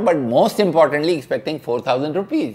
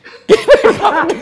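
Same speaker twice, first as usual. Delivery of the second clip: high burst of laughter; 7 dB louder than usual. Real, not acted. Bursts out laughing mid-quote.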